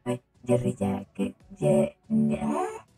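ordinary talk

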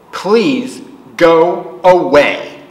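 slow and firm; slow, firm brush-off. Acted. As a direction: lay each word down deliberately. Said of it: "he wrote firmly".

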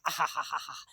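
a soft, crooned ahahaha; crooning aha. Pretend. Vlogger crooning between song lines, soft sung vocalise.

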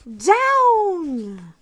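loud high shout of Down; calling out. A narrator's reading. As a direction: call it out loud to land. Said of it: "cried the witch".

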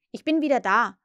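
loud irritated call; peeved and loud. A narrator's reading. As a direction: call out, annoyed. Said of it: "rief er gereizt".

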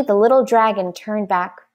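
usual speaking voice

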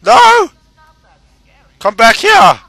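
high, loud yell after an enemy; shouting. Real, not acted. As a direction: bellow at him to come back. Yelling at fleeing game enemy.

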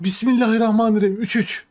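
high, rapid excited burst; excited gasped invocation. Real, not acted. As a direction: blurt it out high and fast. Goal celebration, tense match.

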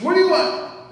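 barked loud and high, a hostile biker at the door; gruff biker demand. Retold or imitated. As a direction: challenge the stranger at your door.

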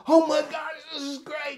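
high, gushing squeal; a giddy squeal that fades off; overexcited. Shown as a demonstration. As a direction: overdo the excitement on purpose. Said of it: "you don't wanna go".

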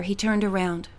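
ordinary delivery, nothing marked

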